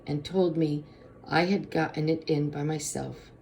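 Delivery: usual speaking voice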